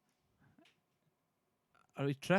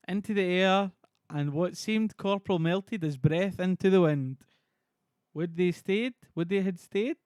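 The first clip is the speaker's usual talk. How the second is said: loud, raised, declaimed verse; theatrical Shakespearean reading; 4 semitones higher, 6 dB louder than usual. Acted. Streamer voices Macbeth's lines on Twitch, loud and theatrical. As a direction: declaim it like a stage actor.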